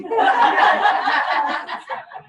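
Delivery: loud laugh; loud amused laugh. Real, not acted. Laughs about being recorded.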